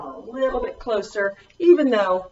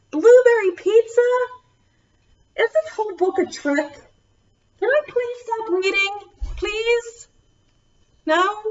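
much higher, whiny begging; mock pleading; 12 semitones higher than usual. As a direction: beg the kids to let you stop. Reader begs to stop reading.

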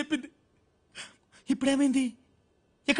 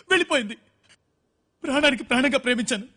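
normal talking voice

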